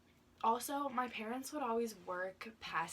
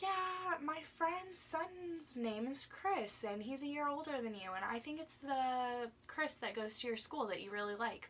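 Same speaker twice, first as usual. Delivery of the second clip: imitating dad; 7 semitones higher than usual. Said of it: "he's just like".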